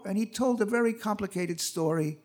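ordinary talk

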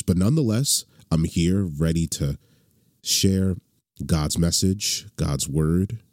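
ordinary delivery

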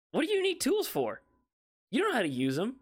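high-pitched mock disbelief; retold incredulous challenge. Retold argument with dad over tools.

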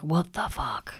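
low, hushed disbelief; airy, appalled under-the-breath reaction; stunned whisper. Retold or imitated. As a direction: whisper it as if overwhelmed. Scared parent's inner reaction, acted.

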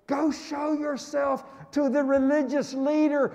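usual speaking voice

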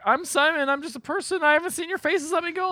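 very high, hammed-up squeal; mock panicked pleading. Imitating Simon begging masked captors, mock panic. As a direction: beg for your life, comically. Voices threatened man pleading for release.

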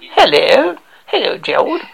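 hammed-up posh English greeting; posh English accent. Shown as a demonstration. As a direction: greet him in a mock upper-class English accent. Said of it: "It's more".

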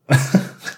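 quick airy laugh; a brief snort of laughter; short laugh. Real, not acted. Laugh mid-confession.

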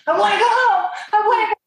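high, desperate mimicked wail; imitated distraught pleading. Husband jerking wife by collar, imitated high desperate pleading. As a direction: imitate a frantic tearful plea. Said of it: "jerks me in".

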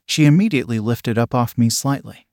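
usual speaking voice

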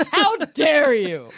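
high, drawn-out hammed-up outrage; mock indignation. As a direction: deliver it slowly as a theatrically affronted rich girl. Acting the offended rich girl.

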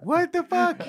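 high, stunned cry of disbelief; can't believe what they're hearing; real surprise; shocked outburst. Real, not acted.

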